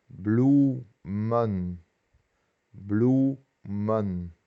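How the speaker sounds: "Bloemen" with OE as a long "oo". Shown as a demonstration. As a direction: sound out "Bloemen" as bloo-men, syllable by syllable. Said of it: "es U".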